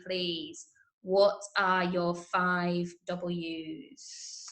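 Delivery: normal talking voice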